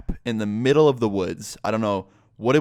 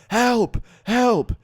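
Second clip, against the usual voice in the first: high, louder acted yell; mock cry for help; 13 semitones higher, 5 dB louder than usual. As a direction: call out for help, pitched way up. Trapped friend calling from hole.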